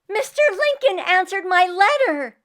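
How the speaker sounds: high, loud burst of news; breathless girl with big news; excited announcement. Acted. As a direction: burst in thrilled, nearly shouting.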